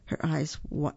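ordinary delivery, nothing marked

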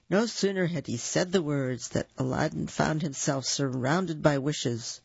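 plain reading voice

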